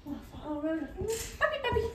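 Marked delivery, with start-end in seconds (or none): 1.4-1.8 very high puppy call; high, playful summons to a puppy; calling a dog. Real, not acted. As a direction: coax the dog over, pitched right up.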